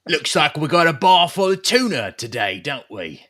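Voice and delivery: high, loud mob boss voice; gangster shark boss. Pretend. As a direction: bark orders like a cartoon mobster. Voicing toy shark boss.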